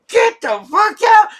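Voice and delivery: high, loud imitated order; mock cop shout. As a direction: yell it like the cops did. Cops yelling, retold.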